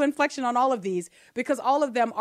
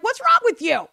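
high, loud disbelieving "what's wrong with you"; incredulous retort; 10 semitones higher, 6 dB louder than usual. Retold or imitated. Others scoffing at a believer's restraint, incredulous. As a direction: fire it back, baffled.

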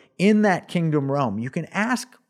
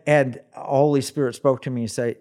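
ordinary delivery

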